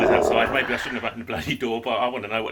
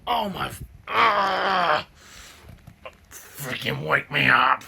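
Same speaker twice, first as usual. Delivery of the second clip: a pained cry then a slow yelled complaint; tired furious yell at being woken; frustrated outburst; 3 semitones higher, 3 dB louder, much slower than usual. Real, not acted. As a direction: let it burst out, fed up and exhausted. Woken again by slamming door.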